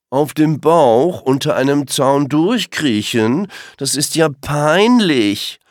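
langsam, höher und lauter, verächtlich gedehnt; spöttisch herablassendes Rehkind; abschätzig. Acted. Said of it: "wirft Blauauge einen abschätzigen Blick zu". (Langbein verspottet Blauauge langsam und abschätzig)

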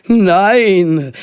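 loud, high, long-drawn refusal; called out. A narrator's reading. Two mothers refusing a sleepover, calling out. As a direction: cry it out and stretch the vowel. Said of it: "riefen beide Mütter im Chor".

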